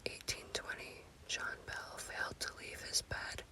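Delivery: ordinary delivery, nothing marked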